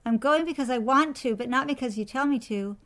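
loud, high defiant call down the stairs; child calling down, defiant and loud; called down defiantly. A narrator's reading. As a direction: shout it back down the stairs, cheeky. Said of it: "she called down to her mother".

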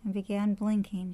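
ordinary delivery, nothing marked

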